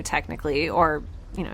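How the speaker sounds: ordinary talk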